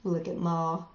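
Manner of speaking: usual speaking voice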